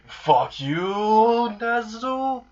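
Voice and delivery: high, drawn-out mock-angry cry; pissed off. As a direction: pitch climbing through a stretched-out curse. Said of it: "Like, he's very pissed".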